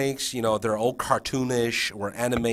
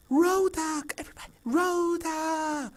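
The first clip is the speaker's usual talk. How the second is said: teaching assistant prompting. Acted. Assistant prompting kids to say the name, high singsong. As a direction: coax the class in a high, unforced voice. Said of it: "the T A is like".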